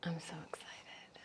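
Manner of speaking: very soft, voiceless whisper; excited whisper. Real, not acted. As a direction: whisper it as excitement too big to say aloud. Hushed excitement about upcoming show.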